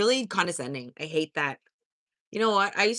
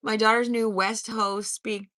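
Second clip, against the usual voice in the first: ordinary talk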